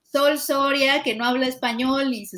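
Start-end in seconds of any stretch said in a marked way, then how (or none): none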